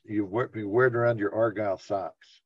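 ordinary delivery

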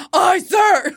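loud, crisp "Aye, sir"; mock military yes-sir; drill sergeant. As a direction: snap back like a soldier on orders. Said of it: "like you're a drill sergeant".

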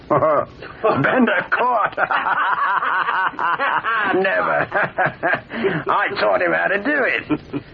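high, incredulous laughing through the words; scoffing laughter. Acted. Grigsby mocking the fear of Bender getting caught, laughing. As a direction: laugh off the very idea, pitch riding high.

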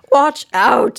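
high, loud alarm call; shouted warning. A narrator's reading. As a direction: cry out a mock danger warning. Mock warning shouted at child.

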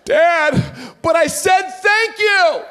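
put-on high, slow, whiny kid protest through laughter; mock crying protest. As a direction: wail it like a crying child. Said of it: "I was crying".

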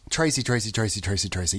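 low, soft murmured name on repeat; playful name repetition. Pretend. Caller toying with Tracy's very fun-to-say name, low playful murmur. Playing with the name's sound.

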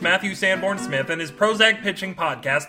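jokey announcer welcome; hyped podcast intro. Acted. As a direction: sell the opening like a radio host. Podcast host's upbeat opening.

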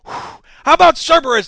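slow theatrical villain reveal; grand villain naming. Pretend. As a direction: unveil the name like a cartoon baddie. Villain picks a name.

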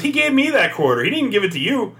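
lightly voiced indignant lady; mock complaining customer; old lady complaining. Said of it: "She goes".

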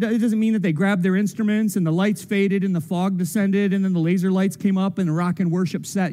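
ordinary talk, nothing marked

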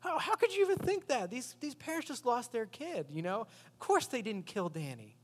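high incredulous protest; Ellie's indignant reply. Retold or imitated. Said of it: "And she says to him".

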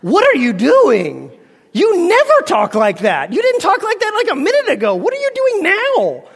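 incredulous challenge. Acted. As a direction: call out the pretender in exaggerated bafflement. Mock challenge to the thespian.

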